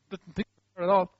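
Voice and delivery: ordinary talk, nothing marked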